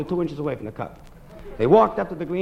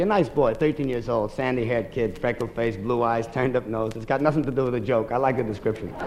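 ordinary talk

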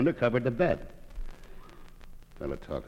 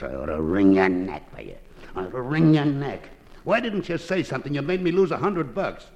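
acted exasperation, climbing higher; mock angry scolding; 4 semitones higher, 5 dB louder than usual. Owner scolds parrot over lost bet, mock angry.

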